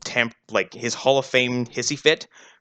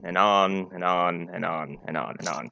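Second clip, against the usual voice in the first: dragging on; 6 semitones lower, 5 dB quieter than usual. Shown as a demonstration. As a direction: drag each repeat out wearily. Acting out a dragging climax.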